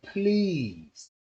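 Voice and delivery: slow, drawn-out plea; retold begging, words dragged out; desperate pleading. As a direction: beg with a stretched-out "pretty please". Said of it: "I was desperately telling everybody".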